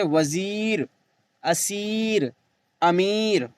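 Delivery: slow, drawn-out ee in "वज़ीर, असीर, अमीर"; teacher's list with a long ee in "वज़ीर"; stretched ya. Shown as a demonstration. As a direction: hold the last ee of "अमीर" before the r. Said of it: "खूब खींच कर पढ़ी जाए".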